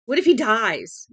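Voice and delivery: loud, high panicked cry; terrified scream. A narrator's reading. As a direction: cry out in terror for him.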